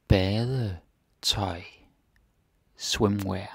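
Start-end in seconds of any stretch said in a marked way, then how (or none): 0.1-1.6 word stretched out, split in parts; drawn-out, segmented pronunciation demo; slow teaching repetition. Shown as a demonstration. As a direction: model it slowly for learners, part by part. Teacher repeats the word slowly.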